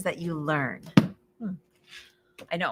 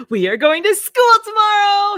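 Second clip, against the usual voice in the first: loud, high, thrilled; excited announcement; 13 semitones higher, 11 dB louder than usual. Acted. Farmer Brown announcing to the pigs, loud and high. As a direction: call the big news out.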